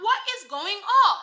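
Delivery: loud, high-pitched mock outrage; retold disbelief. Acting out own shocked reaction.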